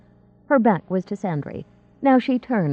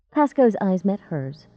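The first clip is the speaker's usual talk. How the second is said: plain reading voice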